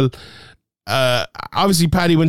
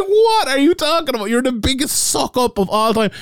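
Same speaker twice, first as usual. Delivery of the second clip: high, exasperated disbelief; high-pitched exasperated ridicule; incredulous scolding; 9 semitones higher than usual. Real, not acted. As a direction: berate him in a high, incredulous voice. Rhetorically berating Paddy for sucking up.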